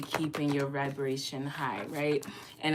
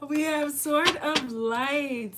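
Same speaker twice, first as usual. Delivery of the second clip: higher, slow, ringing announcement of Sword; excited card reveal; 11 semitones higher, 5 dB louder, slower than usual. Real, not acted. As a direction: unveil the card with delight.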